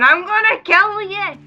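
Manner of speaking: high, loud Glaswegian yell; hammed-up Glaswegian battle cry; Glaswegian maniac. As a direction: rant it in broad Glasgow accent. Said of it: "Running up the middle of Glasgow going".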